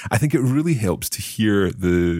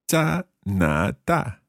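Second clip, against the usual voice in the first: very slow, syllable by syllable; slow learner's repeat; 4 semitones higher, much slower than usual. Shown as a demonstration. Learner repeating the teacher's Chinese phrase, very slowly. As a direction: draw out each tone carefully. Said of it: "saying each of these phrases quite slowly".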